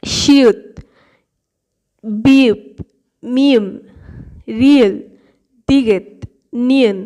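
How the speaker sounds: each Korean letter name said slowly and clearly for learners; Korean অক্ষরের নাম স্পষ্ট করে; model pronunciation. Shown as a demonstration. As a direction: drill শিয়ুত, বিউপ, মিম as a teacher. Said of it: "আমি যেভাবে উচ্চারণ করছি দেখুন".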